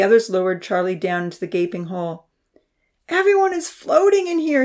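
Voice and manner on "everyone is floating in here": put-on high child's calling voice; called out. A narrator's reading. Charlie calling up from the flooded hen house, high voice. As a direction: call up from inside the hen house. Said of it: "he called out".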